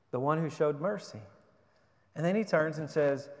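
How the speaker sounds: ordinary talk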